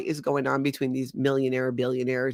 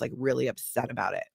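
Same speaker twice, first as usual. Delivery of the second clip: normal talking voice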